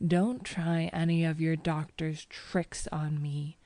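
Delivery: ordinary delivery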